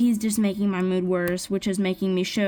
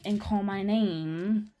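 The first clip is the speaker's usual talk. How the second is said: normal talking voice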